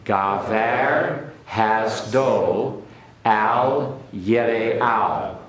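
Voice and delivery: very slow, drawn-out Hebrew in unison; slow choral repeat. Shown as a demonstration. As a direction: stretch every syllable as they repeat. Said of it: "Okay, everyone?".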